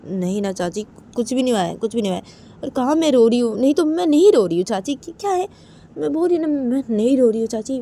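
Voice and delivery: high, loud flustered denial; Uma protesting. Acted. Daughter-in-law insists to aunt she is not crying, emphatic protest. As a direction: insist with rising pitch and force.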